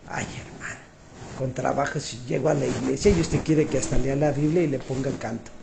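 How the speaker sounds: weary, breathless whisper of a tired member; mock lazy churchgoer. Preacher mocking a tired churchgoer to the congregation, in a weary whisper. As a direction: sigh it out like someone who can barely make it to church.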